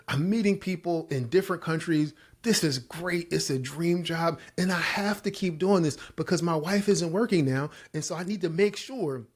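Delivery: high, eager retold argument; insistent self-justifying. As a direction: talk yourself into it with hammed-up enthusiasm. Acts out doubling down on job.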